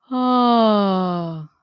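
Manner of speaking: a relaxed sighing oh. Shown as a demonstration. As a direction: open relaxed sigh on oh. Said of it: "Notice that sound".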